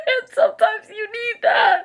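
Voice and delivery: high, loud emotional squeal; gushing. Real, not acted. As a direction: gush in a tiny high-pitched wail. Gushing about caring characters.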